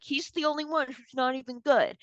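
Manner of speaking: high-pitched dismissive quote; retold scorn. Retold old scorn for actor.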